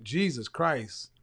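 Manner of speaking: usual speaking voice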